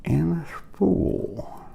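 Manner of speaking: talking around a flashlight held in the mouth; mouth propped open. Real, not acted. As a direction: mumble with something clamped between the teeth. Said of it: "It's hard to talk with a flashlight in your mouth".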